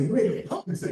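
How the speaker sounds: laughing through the retort; amused disbelief, chuckling the words out. Retold or imitated. Teasing his friend Ron through laughter.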